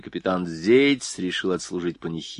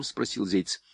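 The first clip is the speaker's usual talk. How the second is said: ordinary delivery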